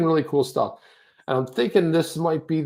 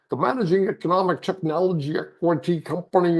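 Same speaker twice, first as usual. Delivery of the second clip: higher, mock tongue-tangled muddle; tripping over words; 5 semitones higher than usual. Shown as a demonstration. Host showing how hard the copy is to read, tripping over words. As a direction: stumble through it like an exaggerated tongue twister. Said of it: "I'm tripping over words like".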